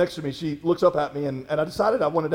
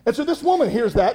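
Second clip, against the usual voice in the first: usual speaking voice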